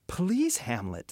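high pleading start falling back down; begging. A narrator's reading. The queen begs Hamlet to stop, pleading. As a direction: beg him, voice leaping up on please. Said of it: "the queen begged".

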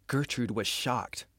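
usual speaking voice

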